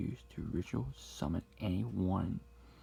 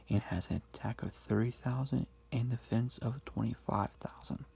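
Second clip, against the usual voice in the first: ordinary talk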